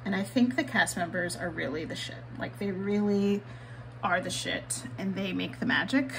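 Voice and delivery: ordinary delivery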